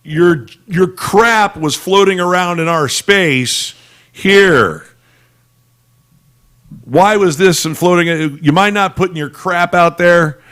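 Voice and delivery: high, slow mock scolding; put-upon alien neighbor telling them off; scolding complaint. Aliens scolding humans over space junk.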